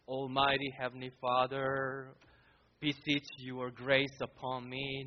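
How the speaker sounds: low, solemn monotone, imitating a lofty prayer; mock formal prayer. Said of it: "did he start his praying like".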